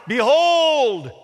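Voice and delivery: loud, high drawn-out shout; crying out. Retold or imitated. John sees Jesus walking and cries out, shouting. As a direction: cry it out to the crowd. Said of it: "he cries out".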